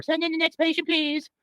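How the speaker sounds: high falsetto nurse; high-pitched comic nurse; nurse character. Acted. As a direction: call the next patient in a high voice. Acts Nurse Dobb.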